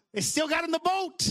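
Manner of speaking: high-pitched, punchy preacher's cry; emphatic proclamation. Real, not acted. Proclaims line, then repeats softly.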